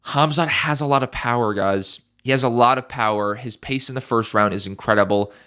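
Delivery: ordinary talk, nothing marked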